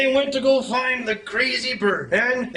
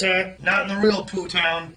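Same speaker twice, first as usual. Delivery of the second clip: ordinary delivery, nothing marked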